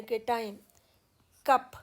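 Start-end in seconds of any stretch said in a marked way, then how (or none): 1.5-1.7 कप with a strong, stressed short a. Shown as a demonstration. Teacher demonstrating the stressed vowel to students, firm. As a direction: hit the vowel in कप firmly. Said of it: "तो अ के ऊपर stress आएगी".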